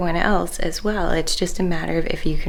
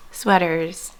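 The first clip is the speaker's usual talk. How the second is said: ordinary delivery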